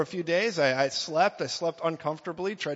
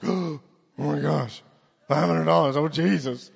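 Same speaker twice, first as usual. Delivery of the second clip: mock shock; 5 dB louder than usual. Shocked at giving five hundred.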